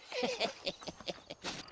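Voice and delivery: a quiet knowing laugh; sly chuckle. Acted. Old man laughing at the stranger, sly chuckle. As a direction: chuckle under your breath at him.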